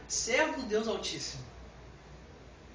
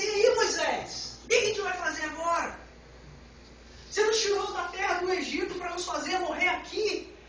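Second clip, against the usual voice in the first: raised-pitch mock complaint; complaining crowd; 6 semitones higher than usual. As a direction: imitate people grumbling at their leader. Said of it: "O povo começa a reclamar". (povo reclamando, em tom mais agudo)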